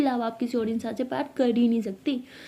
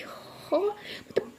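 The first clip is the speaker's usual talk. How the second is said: breathy whispered sulk; whispered sulk to herself; sulky whisper; 9 semitones higher, 8 dB quieter, much slower than usual. Acted. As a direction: hush it under your breath, hurt. Abira sulking to herself.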